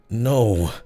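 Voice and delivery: a hint of anguish; pained no. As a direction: force the word out through pain. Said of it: "as if the words themselves were painful".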